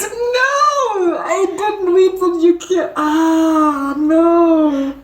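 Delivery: drawn-out mock-heartbroken wail; playful distress. Real, not acted. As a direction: whine in dismay at the news. Listener upset character is killed.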